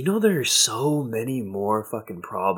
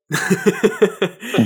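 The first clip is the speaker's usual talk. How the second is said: amused laugh; 8 semitones higher than usual. Real, not acted. Laughing at own story.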